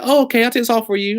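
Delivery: a perky call-centre agent, imitated; mock customer service rep. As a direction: chirp it like a helpful phone rep. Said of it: "And she's like".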